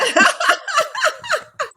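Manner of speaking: loud, high burst of laughter; delighted laugh. Real, not acted. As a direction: break into a big high laugh. Listener delighted by the story.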